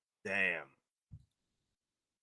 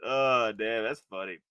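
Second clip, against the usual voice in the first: usual speaking voice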